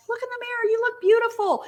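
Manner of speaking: very high imitation of gushing sisters; mock urging. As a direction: gush in a put-on high voice. Sisters urging her, voiced in story.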